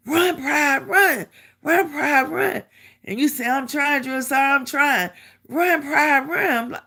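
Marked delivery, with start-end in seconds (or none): 0.1-2.6 high-pitched mock holler; imitated drill sergeant holler. Drill sergeant hollering in a lagging soldier's ear, mock holler. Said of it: "drill sergeant hollering in your ear". 3.5-5.1 retold protest. Exhausted recruit answering hollering sergeant. 5.5-6.6 high-pitched mock holler; imitated drill sergeant holler. Drill sergeant hollering in a lagging soldier's ear, mock holler. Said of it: "drill sergeant hollering in your ear".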